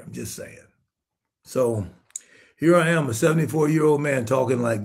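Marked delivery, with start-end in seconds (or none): 0.0-0.7 breathy whispered afterthought, much softer; whispered aside. Real, not acted. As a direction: drop to a whisper to yourself. Muttered aside after sermon point.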